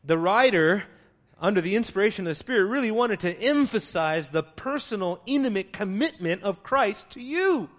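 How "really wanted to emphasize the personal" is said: ordinary talk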